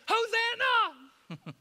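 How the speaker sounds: high, loud ringing cry; crowd shout. Retold or imitated. Preacher voicing the crowd, shouting high and loud. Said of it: "They would say".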